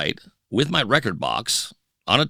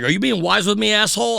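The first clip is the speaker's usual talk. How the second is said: higher, challenging sneer; mock tough-guy threat; 10 semitones higher than usual. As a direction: push back at a cheeky kid, hostile. Promoter threatens him.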